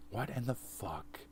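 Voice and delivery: slow, hushed whisper. Acted. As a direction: breathe it out, no voice. Whispered movie-style line.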